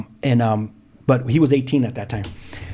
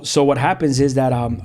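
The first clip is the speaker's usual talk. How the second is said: ordinary delivery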